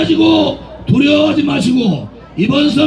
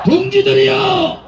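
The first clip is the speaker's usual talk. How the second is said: slow, drawn-out slogan shouts; roaring rallying speech; 3 semitones higher than usual. Real, not acted. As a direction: chant each slogan for the crowd to echo. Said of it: "큰 소리로 제 구호를 따라해 주시기 바랍니다".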